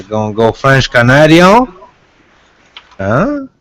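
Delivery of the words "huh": usual speaking voice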